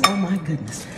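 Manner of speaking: crying quietly from joy; happy tears. Real, not acted. Thanking Walmart for the gift, softly crying happy tears. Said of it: "Happy tears, y'all, happy tears".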